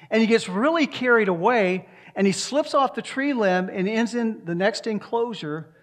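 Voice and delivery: loud, high, brisk storytelling of a funny tale; lively joke telling. Acted. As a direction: tell the zoo joke with big energy. Sermon joke told with energy.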